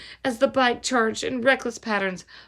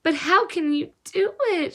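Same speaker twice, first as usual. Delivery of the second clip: a lightly voiced girl's whine, higher; girl whining at her friend, higher; whined; 8 semitones higher than usual. A narrator's reading. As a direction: plead with a mild whine. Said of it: "she whined".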